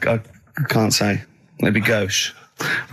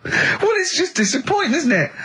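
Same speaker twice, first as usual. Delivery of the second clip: high, whiny fake-tearful moan; mock whimper. Teasing Karl about his boring quiz, high mock whimper. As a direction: pretend to be hurt and nearly crying.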